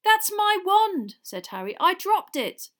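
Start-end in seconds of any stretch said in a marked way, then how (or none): 0.0-1.2 slow, high, loud claim; insistent boy. A narrator's reading. Harry claims his wand. 1.8-2.7 put-on high, loud boyish protest; earnest explanation. A narrator's reading. Harry explains himself.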